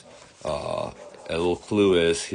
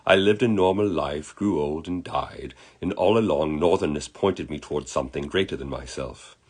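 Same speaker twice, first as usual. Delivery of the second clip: put-on measured amateur British accent; put-on British storybook gentleman; terrible British accent; 3 semitones higher than usual. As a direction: play an earnest Englishman with a shaky British accent. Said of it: "I do a terrible British accent".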